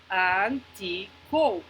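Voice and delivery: "Anti-code" very slow in three beats; "Anti-code" with each syllable apart, final E silent. Shown as a demonstration. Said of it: "la E es muda".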